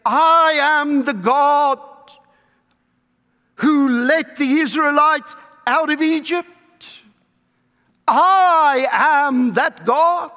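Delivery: slow, emphatic, grand declaration; voicing Jesus. Preacher voicing Jesus to the congregation, slow and emphatic. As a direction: proclaim it slowly as divine claim. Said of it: "Jesus is saying".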